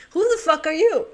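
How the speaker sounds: loud, high laugh into an outburst; laughing shock. Real, not acted. Confused by the cell, laughs and shouts loud and high. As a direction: cackle then blurt it out.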